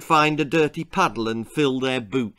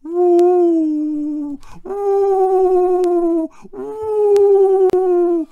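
very high, loud ghostly woo; boy pretending to be a ghost, very high and loud; 9 dB louder, much slower than usual. A narrator's reading. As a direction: howl like a ghost. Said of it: "making loud ... noises".

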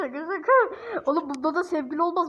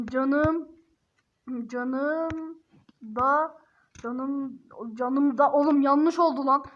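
normal talking voice

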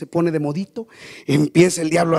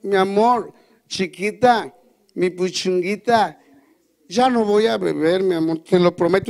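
suplicando meloso; 3 semitones higher, slower than usual. Acted. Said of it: "llega con su mujer y dice".

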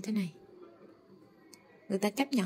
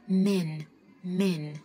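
American man with a leaning toward e. Shown as a demonstration. Teacher demonstrating the American man to students. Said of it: "Theo cách đọc của người Mỹ".

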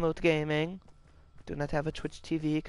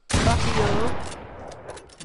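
loud angry outburst at an opponent; angry yell; 6 semitones higher, 8 dB louder than usual. Real, not acted. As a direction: yell it at the player who got you. Shot by an enemy in game.

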